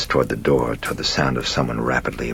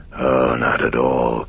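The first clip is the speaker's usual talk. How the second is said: slow, breathy demonic croak; 4 dB louder, slower than usual. Acted. Demon answers the priest's polite question, a slow breathy croak. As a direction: croak it slowly, mostly breath. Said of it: "croaked the demon".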